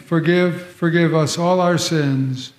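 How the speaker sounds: ordinary talk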